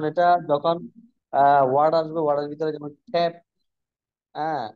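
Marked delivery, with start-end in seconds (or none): 3.1-3.3 "খ্যাপ" opened with breathy kh; aspirated C. Shown as a demonstration. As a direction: puff air on the first sound of "খ্যাপ". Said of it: "এভাবে বলতে হবে". ("খ্যাপ" মহাপ্রাণ খ দিয়ে)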